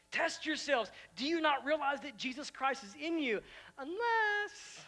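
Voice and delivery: sudden leap up on unless; preaching voice. Acted. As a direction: press the congregation hard. Preaching at full force.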